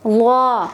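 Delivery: ज़्वा said full-mouthed and thick; heavy zwa. Shown as a demonstration. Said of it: "ज़्वा की अदायगी भी आप ऐसे करेंगे कि मुंह भर के". (ज़्वा मुंह भर के, मोटा)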